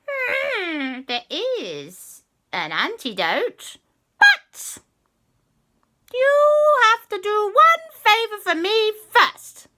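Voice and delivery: very high, slow, loud witchy bargain; sly and drawn out, bargaining; high cat witch. Acted. Cat witch demanding a favour from the children, high and sly.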